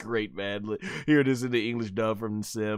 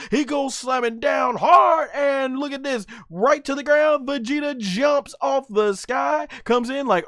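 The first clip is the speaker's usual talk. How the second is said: high, excited fight commentary; live play-by-play; 13 semitones higher, 6 dB louder than usual. Acted. Fan narrating an anime fight to viewers, hyped play-by-play. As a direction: call the action like a ringside announcer.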